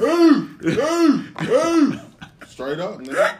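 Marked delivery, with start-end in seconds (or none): 0.0-2.0 loud, high war whoops; hammed-up battle grunts, pitched way up; movie warrior chant. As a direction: hoot like soldiers answering their commander. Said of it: "you ever seen a movie three hundred".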